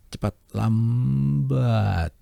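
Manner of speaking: Cepat quick, lambat drawn out slowly; fast then slow. Shown as a demonstration. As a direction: speed contrast, slow on lambat. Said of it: "saya contohin ini".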